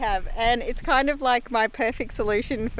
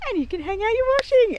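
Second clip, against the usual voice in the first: high presenter voice; 12 semitones higher, 5 dB louder than usual. Acted. Demonstrating the clothesline, playful presenter.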